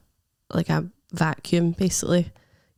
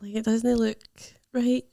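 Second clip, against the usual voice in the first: drawn-out, higher, uncertain mm; halting, careful delivery with long pauses; hesitant; 7 semitones higher, slower than usual. Retold or imitated. Sonographer hedging over the scan to the patient, hesitant.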